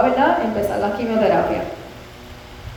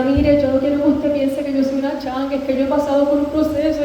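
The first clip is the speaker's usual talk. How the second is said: tearful plea; 4 semitones higher than usual. Retold or imitated. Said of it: "llora y llora y llora".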